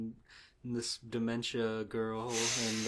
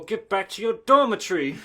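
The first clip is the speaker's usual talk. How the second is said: high, loud bossy order; mock prefect; 8 semitones higher, 12 dB louder than usual. Friend imitates prefects scolding the goth girl, loud and bossy. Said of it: "They go".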